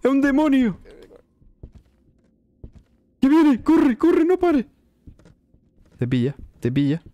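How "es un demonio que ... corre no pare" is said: high panicked yelling; being chased. Real, not acted. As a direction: scream while running for it. Fleeing from the ghost.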